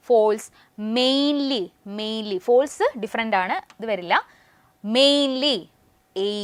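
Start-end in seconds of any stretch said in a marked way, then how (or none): 1.0-2.4 "mainly" with the first vowel held as a long ay; long AY vowel demo. Shown as a demonstration. Teacher pointing out the repeated vowel, "mainly" with a long ay. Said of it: "എ എന്ന് പറയുന്ന sound അല്ലേ". 5.0-6.3 "Mainly" with a stretched "ay", then "എ" alone; long AY vowel demo. Shown as a demonstration. Teacher showing the repeated sound, "Mainly" with a held ay. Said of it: "എ എന്ന് പറയുന്ന sound repeat ചെയ്തു".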